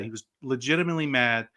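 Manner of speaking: normal talking voice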